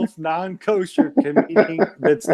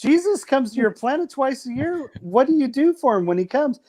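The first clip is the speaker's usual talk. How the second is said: alien voice; 8 semitones higher than usual. Acted. Alien voice in comedy bit.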